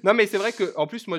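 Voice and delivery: ordinary talk, nothing marked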